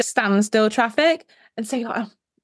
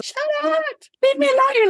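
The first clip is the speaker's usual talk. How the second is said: high mock-fed-up husband, much higher; exasperated Andy; 13 semitones higher than usual. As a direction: squeak it out like a worn-out husband. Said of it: "you sometimes see him and he's just".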